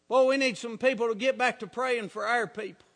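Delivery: ordinary delivery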